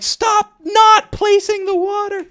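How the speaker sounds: slow, loud, high imitation of furious yelling; Sapnap. Player yelling at teammate about water, imitated angry shout. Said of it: "like, yelling, he's getting pissed".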